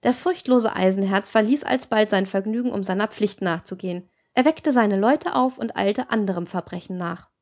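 ordinary delivery, nothing marked

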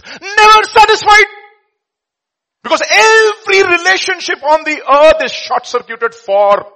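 high, loud preacher's cry; preaching at full force. Real, not acted. Preacher crying out to the congregation, high and loud. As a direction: drive the point home from the pulpit.

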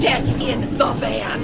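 loud, slow command; shouted order. Acted. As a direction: bark it across the street. Ordering others into the van.

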